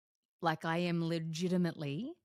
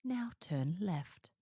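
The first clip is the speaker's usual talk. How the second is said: slow whispered sat-nav direction; Siri voice; much slower than usual. Retold or imitated. Imitating Siri's navigation prompt, slow whisper. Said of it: "Just like Siri will say".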